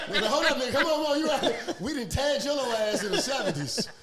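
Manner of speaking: high, laughing banter. Real, not acted. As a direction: talk through laughter. Joking with laughter.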